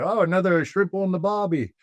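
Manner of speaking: bad English accent drifting toward Australian; Jim Carrey. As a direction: put on a silly, high mock-English accent. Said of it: "doing his English accent".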